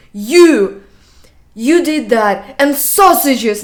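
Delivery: loud furious accusation, voice climbing; angry dad yelling. Retold or imitated. Dad yelling at his son over the hidden pancakes, furious shout. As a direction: blast it at the kid like an enraged father. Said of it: "got my dad so angry".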